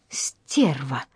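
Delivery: low, soft venomous hiss; hissed insult. A narrator's reading. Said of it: "прошипела она".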